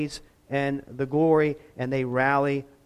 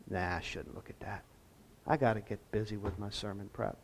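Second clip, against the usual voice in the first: muttered to self; 7 semitones lower, 12 dB quieter, faster than usual. Retold or imitated. Retold inner voice, muttered.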